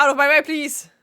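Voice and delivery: loud, commanding gentleman. Shown as a demonstration. Gentleman orders the crowd aside, loud and commanding. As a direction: bark it out to clear the way. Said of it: "wie rede ich denn den jetzt?".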